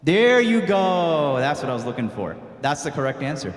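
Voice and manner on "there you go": loud, long-stretched "there you go"; drawn-out approval. Real, not acted. Teacher hearing the answer she was looking for, drawn-out approval. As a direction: stretch it out loudly to applaud the right answer. Student finally gives the awaited answer.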